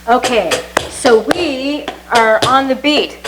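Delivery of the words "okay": ordinary talk, nothing marked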